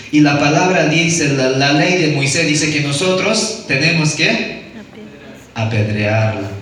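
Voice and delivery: normal talking voice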